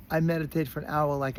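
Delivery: usual speaking voice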